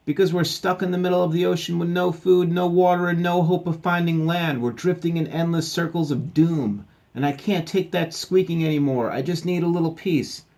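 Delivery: high, loud, ranting doom speech; exasperated captain. Acted. Stranded captain fed up with squeaking, loud exasperated rant.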